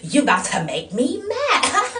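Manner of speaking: slow, high, loud imitation of her; mock angry threat. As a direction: imitate an overblown warning. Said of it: "she was like".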